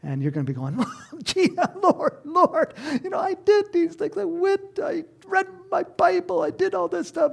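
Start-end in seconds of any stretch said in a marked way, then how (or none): 1.2-7.3 high pleading whine, with a laugh; pleading to the Lord. Retold or imitated. Man pleading at judgment in a high whine. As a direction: beg the Lord, voice pitched way up.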